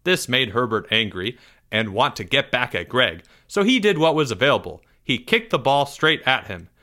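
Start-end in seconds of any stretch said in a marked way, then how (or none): none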